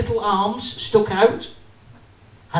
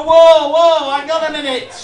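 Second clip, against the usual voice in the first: louder, higher flustered shout; panicked loud protest; 7 semitones higher, 8 dB louder than usual. Acted. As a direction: stop her before she throws more. Customer panicking at fast packing.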